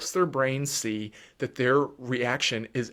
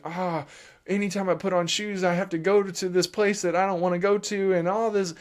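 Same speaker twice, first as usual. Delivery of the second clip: lightly voiced child, higher; a hint of a whiny kid; child's inner voice; 6 semitones higher than usual. Parent voicing the child's dread of going out, lightly whiny.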